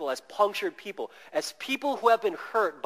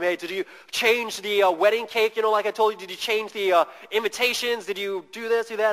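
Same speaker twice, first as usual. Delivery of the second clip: mock interrogation; 4 semitones higher, 6 dB louder than usual. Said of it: "drilling him".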